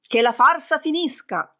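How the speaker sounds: high, commanding cry; loud divine command. A narrator's reading. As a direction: thunder out the command. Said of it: "Zeus gridò ancora più forte". (ordine gridato con forza)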